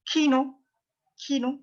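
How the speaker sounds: high-pitched "kino" with airy k; sharp aspirated K. Shown as a demonstration. Teacher repeats the K word for learners, sharp K. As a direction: puff the k in "kino", long i. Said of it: "It's like a k".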